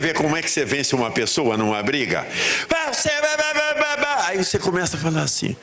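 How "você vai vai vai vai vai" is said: high-pitched mock ranting; mimicked quarrel yapping. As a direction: imitate someone yapping in a fight. Mimics loud quarrel before soft reply.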